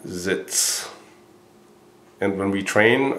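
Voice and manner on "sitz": Sitz said softly with a crisp ts; quiet demonstration of Sitz; German Sitz. Shown as a demonstration. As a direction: repeat Sitz quietly and clearly. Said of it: "I say it again".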